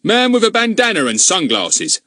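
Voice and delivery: loud, indignant village constable; blustering policeman. Acted. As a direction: bluster like a suspicious bobby. Narrator voices Goon.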